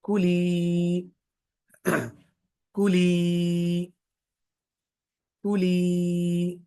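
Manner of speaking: कुली with the ली vowel drawn out long; slow kuli with held final ली; stretched long vowel. Shown as a demonstration. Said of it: "अब उसको हम या के साथ attach".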